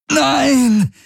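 a high, drawn-out shriek of no; high piercing scream. A narrator's reading. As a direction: shriek it in horror. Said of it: "hörte Kirai Galens Stimme kreischen".

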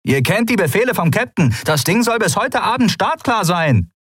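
high, strained yelling; shouted orders. A narrator's reading. Pirate woman reminding crew of captain's orders, shouting. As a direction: drive the crew back to work at a yell. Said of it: "rief die klapprige Frau an der Tür wieder".

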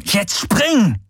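high, urgent command; insistent shout. Acted. Hero presses hesitating companion to jump, insistent shout. Repeated order at cliff edge.

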